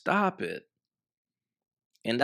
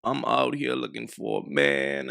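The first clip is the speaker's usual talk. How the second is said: low, drawn-down mimicry of her; mock woman's act; 12 semitones lower than usual. Commentator mimicking a woman who claims she wants a man, mock act. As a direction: put on a low-pitched imitation of her excuse. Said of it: "try to act like".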